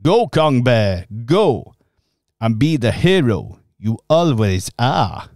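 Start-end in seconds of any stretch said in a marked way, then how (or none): none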